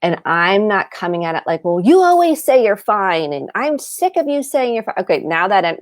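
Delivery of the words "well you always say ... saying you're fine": irritated nagging tone; fed-up accusing. Shown as a demonstration. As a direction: snap at them for always saying fine. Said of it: "do you see my energy shifted?".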